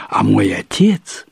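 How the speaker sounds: ordinary delivery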